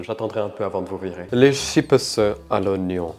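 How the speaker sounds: ordinary delivery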